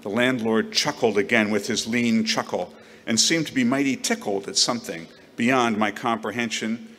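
ordinary delivery, nothing marked

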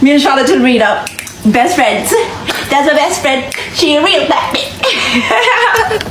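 proud, loud declaration about best friends; loud, laughing brag about her best friend; loud bragging. Real, not acted. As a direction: shout it out, laughing.

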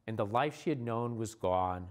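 usual speaking voice

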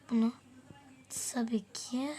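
ordinary delivery

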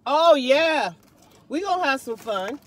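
excited. Real, not acted. Hyped about car fun.

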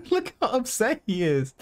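high-pitched laughing words; cracking up at someone. Real, not acted. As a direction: squeeze the words out through giggles. Laughing at upset opponent.

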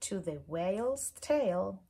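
ordinary delivery, nothing marked

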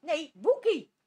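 sharp loud "Nee, Boekie". Real, not acted. Owner loudly stops the dog Boekie. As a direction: stop the dog firmly.

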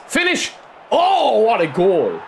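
high, loud goal cry; excited shout. Real, not acted. Gamer cheering his own strike to the lads, excited shout. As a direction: yell the finish in triumph.

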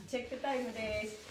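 ordinary delivery

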